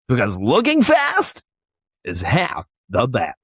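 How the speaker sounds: booming fighting game announcer; big hyped ad-read announcer. Pretend. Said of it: "best, best announcer voice".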